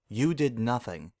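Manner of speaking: usual speaking voice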